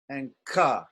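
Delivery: "ka" with a big puff of air; aspirated kha. Shown as a demonstration. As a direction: breathe out hard on it. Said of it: "There's more air".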